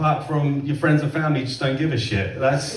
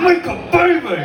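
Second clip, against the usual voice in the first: very high, loud comic holler; mock bedroom yell; 12 semitones higher, 5 dB louder than usual. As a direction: shout it at full tilt, hammed-up. Acts out bedroom shouting, escalated.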